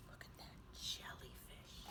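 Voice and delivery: put-on hushed whisper to the kids. Teacher pointing out the jellyfish to children, hushed whisper. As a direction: whisper it like sharing a secret.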